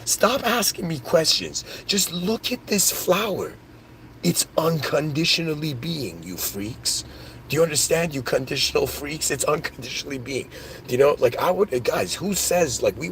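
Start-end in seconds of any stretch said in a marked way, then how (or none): 0.1-10.5 lightly voiced Buddha, pitched higher, scolding the crowd; lofty Buddha voice. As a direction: play an exasperated sage telling everyone to shut up. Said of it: "And Buddha was like".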